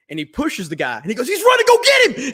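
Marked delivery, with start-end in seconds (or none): 1.3-2.3 high, loud yelled alarm; retold shout. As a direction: bellow it like raising the alarm. Manager's shout in retold story.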